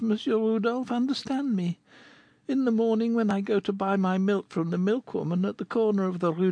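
put-on high, girlish voice telling her sad tale; young girl Goualeuse. Goualeuse tells Rodolphe her story, in a high girlish voice. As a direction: speak as the poor young girl recalling her past.

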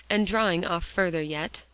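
plain reading voice

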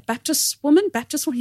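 high-pitched questioning in an imitated Papuan accent; bad Papuan accent. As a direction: ask excitedly, high, in a badly faked Papuan accent. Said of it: "automatic bad accent".

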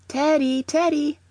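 high, loud call across the street. A narrator's reading. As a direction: sing out a name to someone far off. Old lady calling across the way.

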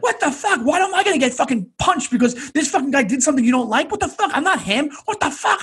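high, exasperated mock outburst; losing his mind. Cornered man re-enacting his meltdown before the gang, frantic and high. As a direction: spike the pitch on each indignant curse. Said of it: "I'm losing my mind".

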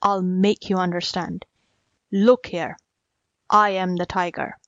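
understated low-pitched anger; in a rage. A narrator's reading. Tiger making the jackal understand, mildly enraged. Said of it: "in a rage at the jackal's stupidity".

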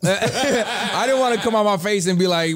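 laughs through the words, voice lifted; laughing. Real, not acted. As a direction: chuckle while you tell it. Laughing while retelling the scene.

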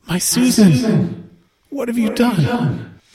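high, slow, anguished mother's voice; grieving mother. Acted. Grieving mother's echoing voice in his head, high slow anguish. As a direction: let the loss crack through, slowly. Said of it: "what you hear is".

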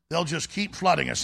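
ordinary talk

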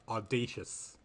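usual speaking voice

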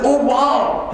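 high, thrilled "oh wow"; mock joyful child; delighted kid. Happy twin finds shit room.

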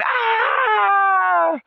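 high panicked shriek; mock scream. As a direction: scream like big guys fleeing in terror.

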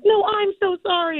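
flustered fan. Retold or imitated. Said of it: "And I'm like".

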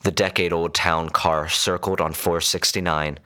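ordinary delivery, nothing marked

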